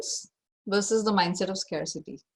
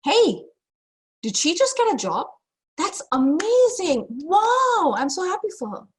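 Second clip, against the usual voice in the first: high, loud, thrilled; delighted for a friend; 10 semitones higher, 7 dB louder than usual. Acted. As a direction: gush with joy for her. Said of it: "The mindset of abundance is saying".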